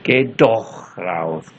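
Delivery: slow "Geh doch raus," leaning on "doch"; stress on doch. Shown as a demonstration. Lecturer demonstrating the particle to students, slow and pressed. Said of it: "Partikel doch di situ itu memberi penekanan".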